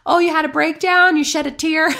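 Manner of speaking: high-pitched mock concern; joking tease. Friends ribbing her over a tiny cry, joking. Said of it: "My friends joke".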